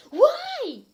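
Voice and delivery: hammed-up squeal, very high and loud; exclaiming. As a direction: blurt it out as a shrill shocked Why. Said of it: "I exclaimed".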